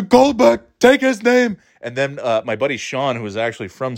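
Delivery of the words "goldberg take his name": put-on high, barking old New York teacher; Ken Jacobs impression. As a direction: call out orders like a crusty New York old-timer. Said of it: "that's what Ken Jacobs sounded like".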